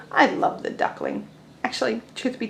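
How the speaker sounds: soft whispered aside. Real, not acted. Teacher whispering her love for the duckling to the class.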